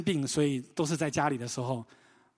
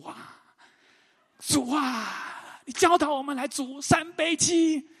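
high, slow, hammed-up plea to the Lord; mock pleading prayer; 11 semitones higher, 4 dB louder, slower than usual. Youth team kneeling before the chicken, high mock-desperate prayer. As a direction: beg God for cooking help, over the top.

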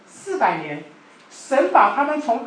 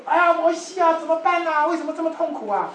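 pleading cry; 7 semitones higher than usual. Retold or imitated. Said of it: "跟摩西讲说".

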